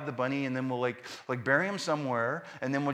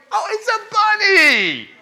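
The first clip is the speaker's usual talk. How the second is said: exasperated outburst; 12 dB louder than usual. Retold or imitated. Dad rants at his weeping family over the dead bunny, high and loud. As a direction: plead with them to cheer up.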